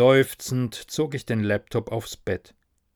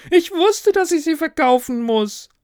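very high, loud, ghostly wail; howling; 7 dB louder than usual. Acted. Ghost learns shares are worthless, despairing howl. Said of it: "heulte er".

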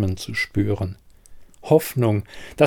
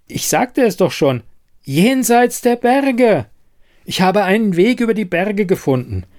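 high and loud, impassioned; insistent; 8 semitones higher, 3 dB louder than usual. Acted. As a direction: make them believe you. Siren insists to doubting men.

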